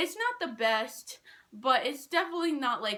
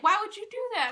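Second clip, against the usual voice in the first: higher, louder imitation of a coach scolding; playfully ape the coach's higher, louder scolding; mock coach scolding; 10 semitones higher, 4 dB louder, faster than usual. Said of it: "but he's just like".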